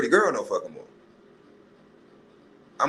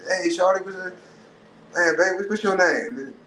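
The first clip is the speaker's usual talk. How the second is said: smooth catcaller; 7 semitones higher than usual. Retold or imitated.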